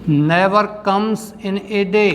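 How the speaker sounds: slow phrases with long pauses between them; drawn-out, measured dictation. Shown as a demonstration. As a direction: dictate the answer for students to write down. लिखिए इसका answer.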